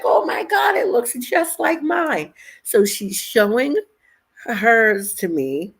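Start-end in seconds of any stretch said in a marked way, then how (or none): 0.0-2.3 much higher-pitched imitation of an amused friend; retold hilarity. Coworker delighted to compare in the dressing room, retold in high-pitched excitement. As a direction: squeal it as your tickled friend would. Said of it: "She's dying laughing".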